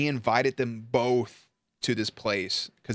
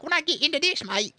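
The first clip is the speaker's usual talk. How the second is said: high, over-the-top mock British or Australian accent; hammed-up mock British or Australian accent, pitched up; weird mate accent. Shown as a demonstration. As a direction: put on a silly "mate" accent. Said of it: "when I do my weird accent".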